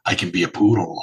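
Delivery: lightly voiced low creepy creature; snooty poodle voice. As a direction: coax like a lurking monster. Monster offers to be a poodle.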